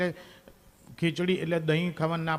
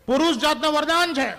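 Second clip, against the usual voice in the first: much higher and louder declaration; loud proclamation; 9 semitones higher, 7 dB louder than usual. Acted. Comedian declares men's boon to audience, loud and high. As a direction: announce it to the hall.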